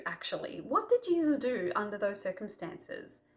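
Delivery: ordinary delivery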